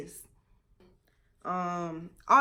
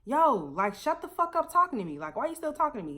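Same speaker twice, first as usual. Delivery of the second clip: telling her off, raised; mock angry yell; 6 semitones higher than usual. Sailor yelling at a shipmate across the table, heated. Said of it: "I'm just like".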